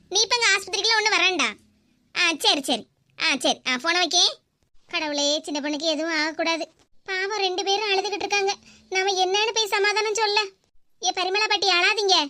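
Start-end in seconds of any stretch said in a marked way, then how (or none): none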